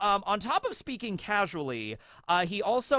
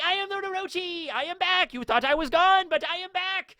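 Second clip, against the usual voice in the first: high-pitched, hammed-up villain proclamation; Orochi. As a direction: crow your comeback like a cartoon villain. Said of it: "like an Orochi situation back at Wano".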